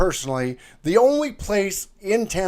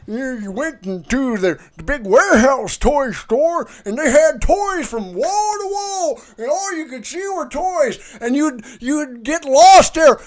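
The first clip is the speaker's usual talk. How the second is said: put-on old man voice; 11 semitones higher, 6 dB louder than usual. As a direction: play a doddering old timer reminiscing. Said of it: "Old man voice".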